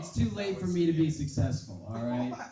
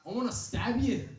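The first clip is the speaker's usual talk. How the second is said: higher, louder mock drunk threat; drunk Irishman threat; 9 semitones higher, 4 dB louder than usual. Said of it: "he says".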